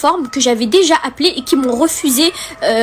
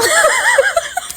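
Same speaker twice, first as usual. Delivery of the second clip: high, bursting laugh. Real, not acted. Laughing at herself in the middle of her story. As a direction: break into helpless laughter.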